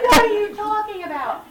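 high, half-laughing disbelief; giggly what-are-you-saying. Retold or imitated. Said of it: "And I was like".

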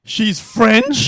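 loud, high shocked question; surprised. Real, not acted. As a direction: gasp it out in disbelief. Shocked reaction to news.

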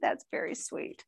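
"that's very sweet" whispered, almost no voice; whispered thanks. Real, not acted. Touched by gift, whispers.

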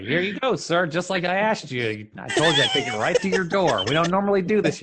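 high mock-helpful driver voice; hammed-up customer-service politeness; obliging bus driver. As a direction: play a cheerily obliging driver. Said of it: "He's like".

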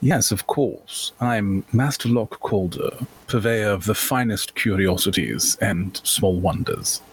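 put-on cultured auction-goer with a college accent; courteous gentleman merchant. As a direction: introduce yourself with polite old-world formality. Player speaks as Master Locke Calder.

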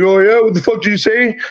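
high taunting mock challenge; imitating a golfer picking a fight; mock tough guy. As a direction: bark it like a guy itching to fight. Third guy challenges before punch.

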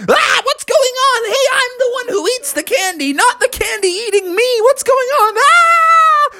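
put-on a kid about to be eaten, yelping; shrill high panic ending in a scream; panicked Bubby. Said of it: "And he was like".

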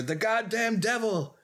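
half-playful mad exclamation; mock anger. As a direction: imitate an angry insult, pitch lifted. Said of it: "gets really, really mad at Sarah".

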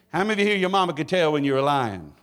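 ordinary talk, nothing marked

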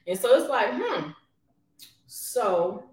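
talking while popping gum; popping bubble gum. Real, not acted. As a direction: chew and pop gum between words. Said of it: "Stop popping that gum".

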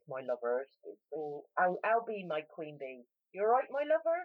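broad West Country imitation; West Country accent. Shown as a demonstration. Showing how West Country locals talk, broad West Country accent. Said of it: "where they all talk like this".

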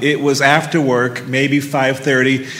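plain reading voice

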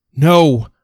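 sharp desperate shout; yell. Acted. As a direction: yell it out in panic. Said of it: "Progeny yells".

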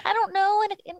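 high, strained voice near tears; reliving the panicked kitchen moment; wife tells husband, high and teary; tearful panic. Retold or imitated. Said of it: "And I said".

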